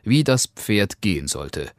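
ordinary delivery, nothing marked

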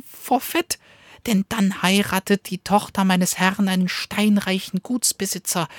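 high, fast, bragging dog voice; sly little dog. Acted. Cunning little dog tempts the wolf, high and eager. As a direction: tempt him with a tiny eager voice.

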